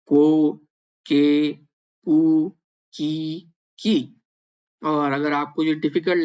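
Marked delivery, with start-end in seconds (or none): 0.1-4.0 को, के, कू, की drawn out slowly one by one; slow syllable drill. Shown as a demonstration. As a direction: sound out को के कू की slowly for learners. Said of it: "तो ये हो गया का". (धीरे-धीरे अक्षर उच्चारण)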